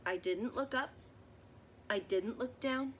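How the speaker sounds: put-on gentle read-aloud for children, measured pace; story narration. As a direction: read it like a bedtime picture book. Reading picture book aloud.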